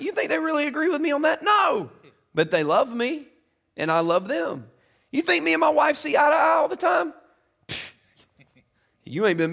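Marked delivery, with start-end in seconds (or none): 0.0-7.1 shouting from the pulpit; fiery preaching. Real, not acted. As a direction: drive it home to the congregation. Preaching at full force.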